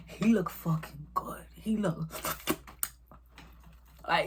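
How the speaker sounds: breathy whisper, slow; whispering about a hot guy; whispered gushing. Real, not acted. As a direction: hush it like a secret.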